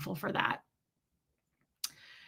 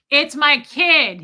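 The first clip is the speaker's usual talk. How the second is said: loud, slow, raised-pitch imitation of dad; big public holler, retold; dad announcing loudly; 6 semitones higher, 9 dB louder, slower than usual. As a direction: announce it to the whole bar. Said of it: "he says out loud".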